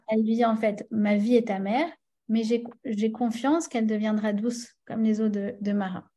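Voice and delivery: ordinary delivery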